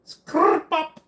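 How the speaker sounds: sharp, very high squeaky pop; tiny pop noise squeaked out on command; requested sound effect. Pretend. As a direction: blurt out a silly high-pitched noise on request. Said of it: "why you would ask me to say that".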